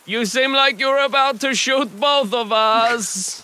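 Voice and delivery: hammed-up German filmmaker; German accent. German cameraman scolding the noisy pair, in a thick hammed-up German accent. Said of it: "this very accurate accent".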